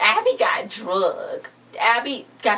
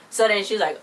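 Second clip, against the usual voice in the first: usual speaking voice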